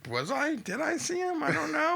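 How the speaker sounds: high-pitched, befuddled self-questioning; acted confusion. Host imagining being high in the costume, confused high voice. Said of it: "confuse yourself to be like".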